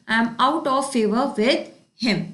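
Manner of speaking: very slow, each word laid out for students; slow idiom read. Shown as a demonstration. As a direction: read it slowly like a teacher. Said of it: "teacher reads idiom aloud". (बहुत धीरे, पढ़ाते हुए)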